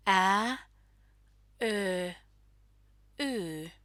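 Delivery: ä, ö, y as separate front vowels. Shown as a demonstration. Teacher showing learners the front vowels ä, ö, y, separately sounded. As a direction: sound out Finnish ä, ö, y one by one. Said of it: "pronounced towards the front of the mouth".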